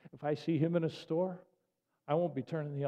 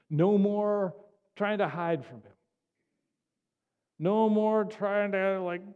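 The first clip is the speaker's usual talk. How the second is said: raised, ringing preacher's cry, higher and louder; fervent sermon build, higher and louder; preaching at full force; 3 semitones higher, 4 dB louder than usual. Acted. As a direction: drive it home from the pulpit. Preaching climax.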